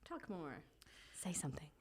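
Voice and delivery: whispering. Pretend. Whispered prompt to talk.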